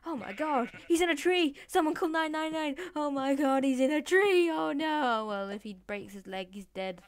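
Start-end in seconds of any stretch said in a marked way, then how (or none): 0.1-5.3 high, loud hammed-up alarm; mock panic. Bystander calling nine nine nine, mock panic. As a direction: cry out for emergency help.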